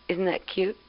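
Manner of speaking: ordinary delivery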